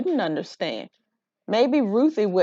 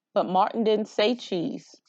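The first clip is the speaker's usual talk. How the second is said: ordinary delivery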